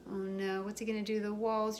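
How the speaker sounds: usual speaking voice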